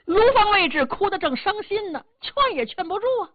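plain reading voice

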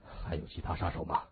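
low, hoarse, drained question. A narrator's reading. Injured boss fighting dizziness to question his men, hoarsely. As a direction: force it out hoarsely through dizziness. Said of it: "嗓音沙哑地说".

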